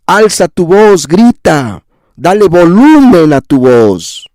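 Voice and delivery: insistent, high-pitched exhortation; slow, building call to cry out. Shown as a demonstration. (alzando la voz con insistencia)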